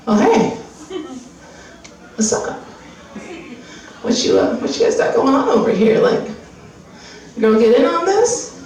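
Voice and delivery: a puppy sidling up to the weed; nosy puppy voice. Retold or imitated. Puppy sidles up to friends smoking, casual and nosy. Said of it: "and the dog's like".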